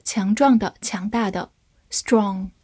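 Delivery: ordinary delivery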